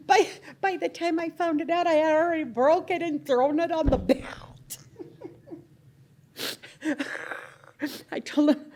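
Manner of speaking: loud, high, through leftover laughter; real amusement. Real, not acted. Miner recalls breaking the rock too soon, loudly amused. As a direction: push the punchline out over laughs. Laughing over her own story.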